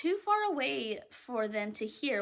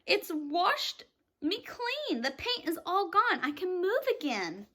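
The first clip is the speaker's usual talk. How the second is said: excited; 6 semitones higher than usual. Acted. Centipede washed clean, higher delighted exclaim. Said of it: "shouted the centipede excitedly".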